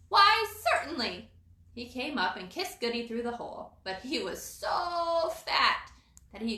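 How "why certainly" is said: squirrel voice. Acted. Timmy answers from below.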